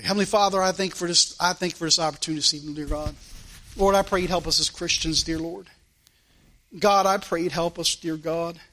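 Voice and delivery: low, subdued pastoral prayer. Real, not acted. Pastor asking God to help us as Christians, prayer. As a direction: drop low and pray humbly, letting each address to God fall away.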